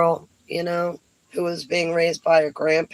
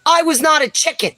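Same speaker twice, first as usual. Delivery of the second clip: high, loud, fast proud outburst; emphatic boast; 11 semitones higher, 5 dB louder, faster than usual. Acted. Woman proving she took the scratch test, loud defiant boast. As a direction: blurt it out defiantly, pitched way up. Proud punchline of childhood dare.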